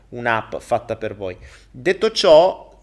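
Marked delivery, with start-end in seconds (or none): 1.7-2.8 loud, slow announcement; announcing. Real, not acted. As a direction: call it out slowly. Loud drawn-out transition.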